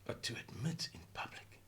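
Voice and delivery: sudden hushed whisper. Real, not acted. Speaker to viewers, confiding in a whisper. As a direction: drop to a breathy whisper, as if confiding.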